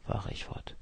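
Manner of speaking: ordinary delivery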